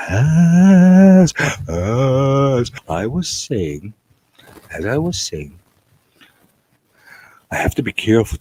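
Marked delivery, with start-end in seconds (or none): 0.1-3.9 high, very slow stretched vowels; drawn-out warm-up. Pretend. Trying out the voice before resuming, drawn-out and high. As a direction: hold each "as" long and high. Stretches the phrase, then restarts normally.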